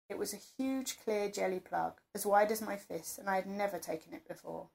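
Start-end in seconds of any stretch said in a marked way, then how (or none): none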